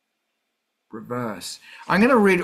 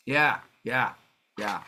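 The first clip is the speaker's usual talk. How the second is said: normal talking voice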